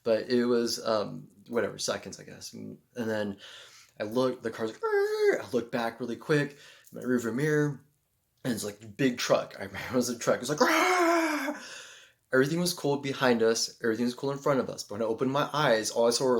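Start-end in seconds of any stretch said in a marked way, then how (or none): 4.8-5.4 high, loud braking screech; imitated screech of brakes; screech imitation. Car braking sound. 10.6-11.6 high, loud braking screech; imitated screech of brakes; screech imitation. Truck braking.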